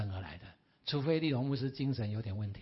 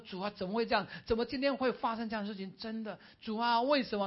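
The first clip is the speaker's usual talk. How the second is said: higher, pleading cry to God; anguished crying prayer; 6 semitones higher, 3 dB louder than usual. A narrator's reading. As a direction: voice the pastor's grief, lifted and pleading. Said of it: "他一直哭，一直哭". (牧师痛哭着向主呼求)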